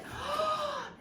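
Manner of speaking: sharp delighted gasp; a high gasp of delight; thrilled intake of breath. Real, not acted. Unboxing a gift, delighted gasp.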